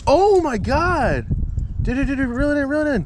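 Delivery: urgent, high excited calls. Real, not acted. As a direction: yell it out as the line pulls. Friend hooks a fish, excited yells.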